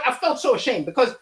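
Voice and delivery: ordinary delivery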